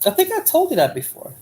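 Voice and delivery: pointed high reminder. Real, not acted. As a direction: say it up high, teasing the chat. Repeats the line to the chat.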